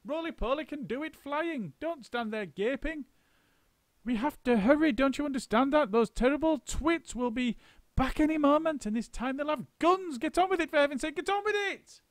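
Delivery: very high, rushing frantic orders; a frantic monkey whipping up his crew; high frenzied monkey urging; frenzy of excitement. A narrator's reading. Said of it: "in a frenzy of excitement".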